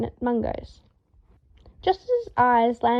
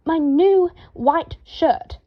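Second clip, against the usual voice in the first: slow, high, loud snarl; 5 semitones higher, 5 dB louder, much slower than usual. A narrator's reading. Draco accusing the ferret to Harry, snarling. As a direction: drag out each word in fury. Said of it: "Draco snarled".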